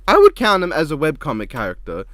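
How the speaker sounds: would punched loud and firm; stress on would. Real, not acted. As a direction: lean hard on would. Insisting in a friendly debate.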